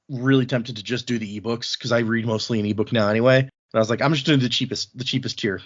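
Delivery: normal talking voice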